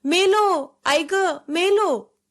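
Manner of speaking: put-on a drowning boy screaming for his mother; terrified scream, slowing on मेलो; panicked cry. A narrator's reading. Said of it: "मी ओरडलो".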